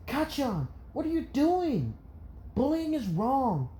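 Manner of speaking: put-on very high, earnest child voice; indignant little boy. Izuku stands between Bakugo and kid, indignant child. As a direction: stand up to him, piping high.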